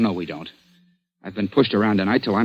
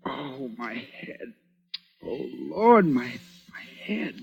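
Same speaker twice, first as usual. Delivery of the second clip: weak, groaning moans; just waking from being knocked out; dazed and hurting; 3 semitones higher, 8 dB quieter, much slower than usual. Acted. Coming to after a blow.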